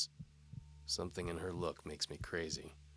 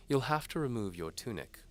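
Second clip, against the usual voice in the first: higher, louder teasing lady voice; seductive noblewoman; 7 semitones higher, 5 dB louder than usual. A narrator's reading. Antimony dares the knight to follow. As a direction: tease the knight like a bold noblewoman.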